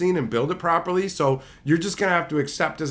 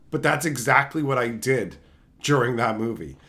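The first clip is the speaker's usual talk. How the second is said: ordinary talk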